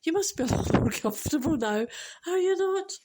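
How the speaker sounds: tearful laugh, voice rising high at the end; emotional retelling that climbs in pitch; about to cry. Real, not acted. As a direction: push the quote out while welling up. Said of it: "I'm gonna cry again".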